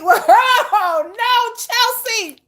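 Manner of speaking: high, loud laughing disbelief; playful shocked protest. Real, not acted. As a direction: wail a scandalized objection through laughter. Reacts to viewer's belly comment.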